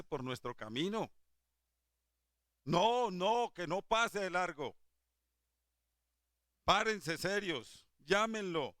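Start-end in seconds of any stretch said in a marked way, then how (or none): 2.6-4.8 louder and higher, pleading with the people; don't let him pass, urge them hard; preacher urging the congregation not to let Jesus pass; urgent exhortation. Real, not acted. 6.6-8.8 louder and higher, slow and firm; preacher telling the congregation to stand and call Jesus; forceful command. Real, not acted.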